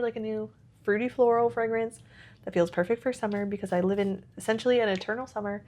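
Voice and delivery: ordinary talk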